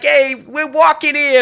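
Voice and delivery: high, loud, slow New York drawl; hammed-up New York tough guy; stretched-out New York accent parody; mock New York accent. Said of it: "they always like".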